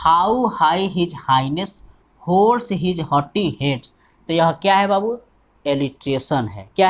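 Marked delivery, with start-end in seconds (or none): 0.1-3.9 dictating slowly, pushing every opening h; initial h stressed. Shown as a demonstration. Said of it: "उसी को बार बार repeat किया जाता है".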